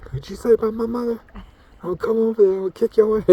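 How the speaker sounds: put-on high, loud, fast drunk bar brawler; belligerent drunk. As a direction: slur a bar threat, picking a fight. Voicing animal picking a fight.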